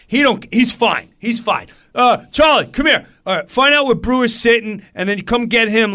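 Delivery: high, loud rapid-fire orders; bossy bandmate. Retold or imitated. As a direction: take charge and call someone over. Guitarist takes charge, giving orders.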